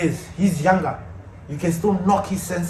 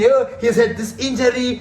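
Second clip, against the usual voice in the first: mock excuse; 4 semitones higher than usual. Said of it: "people are gonna come in".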